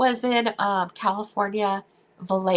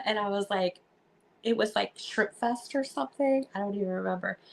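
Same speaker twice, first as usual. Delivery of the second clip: normal talking voice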